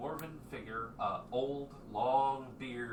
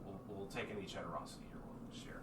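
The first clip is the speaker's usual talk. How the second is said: much softer, fast, near-whispered; humbly asking for charity; meek whisper; 9 dB quieter, faster than usual. Acted. Stranger begging the group, whispering.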